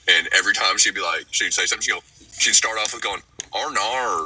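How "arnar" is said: mock-Aussie "Arnar", vowels bent toward "ar"; thick Australian accent. Retelling the Uluru worker's catchphrase, imitated thick Australian accent. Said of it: "she had a thick accent".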